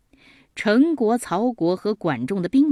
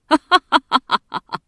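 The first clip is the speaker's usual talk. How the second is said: loud, high acted belly laugh; hearty, self-satisfied stage laughter; triumphant laugh; 6 semitones higher, 6 dB louder than usual. A narrator's reading. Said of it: "还挺得意的笑着说".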